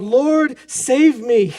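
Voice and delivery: loud, high desperate cry; cry for help. Acted. Peter sinking in the storm, crying out to Jesus, loud desperate cry. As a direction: shout it like you're sinking. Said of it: "he cries out".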